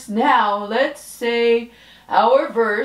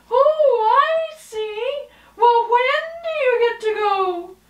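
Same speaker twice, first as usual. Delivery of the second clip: high, fast, bubbly puppet voice; much higher chipper cartoon girl voice; 13 semitones higher than usual. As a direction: chirp it like a perky little puppet.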